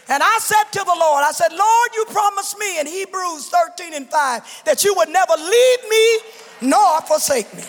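high shouted preaching, scripture hammered out; preaching at full force. Real, not acted. Widow preaching to her church about fighting grief, shouting. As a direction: preach it like a battle cry.

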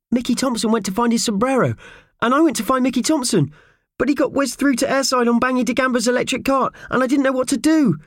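very high little voice, unhappily explaining; unhappy Purvis. A narrator's reading. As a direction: fret through the explanation in a high-pitched voice. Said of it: "said Purvis unhappily".

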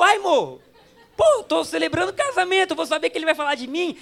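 high, loud, laughing self-defence; comic protest. Retold or imitated. Pastor defending himself to his wife, comically exasperated.

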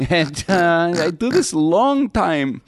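slow, high, loud Romanian driver impression; cabbie near breakdown. Cab driver pleading his long experience, mock breakdown. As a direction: whine it out, worn to breaking point. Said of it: "a guy on the verge of a breakdown".

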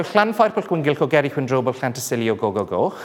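long Welsh place name said carefully. Shown as a demonstration. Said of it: "let's try one more".

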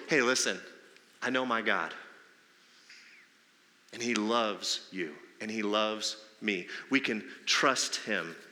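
slow, soft, low reassurance to a hurting friend; tender reassurance. Acted. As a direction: lean in and calm them gently. Comforting a struggling friend.

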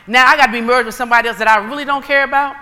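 ordinary delivery

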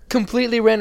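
high, loud incredulous; excited disbelief. Real, not acted. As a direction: marvel at the absurdity. Reaction to text.

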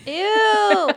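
loud high ew; disgusted. Real, not acted. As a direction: squeal in disgust. Reacting in disgust to a joke.

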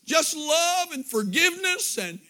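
high, loud, building preacher's cry; climbing revival sermon voice; rising preaching. Acted. Preaching at full force.

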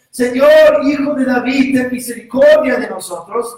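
loud, high pleading call; crying out. Acted. Blind men crying out to Jesus from the roadside, loud pleading. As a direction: shout it out, begging for mercy. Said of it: "clamaron diciendo".

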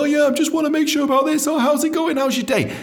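very high sing-song chirpy voice; butter them up sweetly; high, bright mock-friendly phone voice; cheeky friendly call. Shown as a demonstration. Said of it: "cheeky call before and go".

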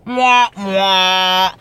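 two loud smooching kisses; kiss sounds. Pretend. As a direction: blow two big kisses. Blowing kisses.

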